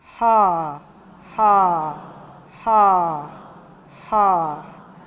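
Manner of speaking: low, loud voiced "ha" pulses, one at a time; voiced ha demo. Shown as a demonstration. Teacher showing the class a voiced, low "ha". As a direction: push out low, firm "ha, ha, ha, ha". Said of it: "add voice to that sound".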